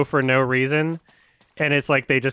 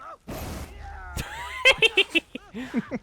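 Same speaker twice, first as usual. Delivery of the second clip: high shrieking witch cackle; 5 dB quieter than usual. Pretend. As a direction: cackle like an old hag. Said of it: "We got a witch cackle".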